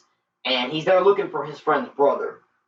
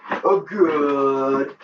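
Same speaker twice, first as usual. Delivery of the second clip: playful two-part goodbye, building; showy sign-off; 3 semitones higher, much slower than usual. Pretend. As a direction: stretch out the goodbye, then pop up high. Stylised sign-off.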